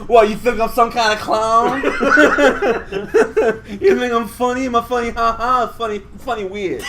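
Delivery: hammed-up New York accent, mistakable for Boston; shitty New York accent. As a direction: put on a bad New York accent, laughing through it. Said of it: "What's with your Boston accent".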